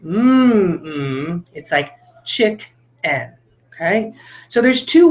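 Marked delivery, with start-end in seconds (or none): 0.0-1.4 two hummed beats, lips closed; hummed syllables. Shown as a demonstration. Teacher humming "chicken" for the class. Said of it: "feel the two beats". 1.5-3.3 slow, "chick-en" in two spaced beats; syllables split apart. Shown as a demonstration. Teacher breaking "chick-en" into syllables. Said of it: "the two beats of that word".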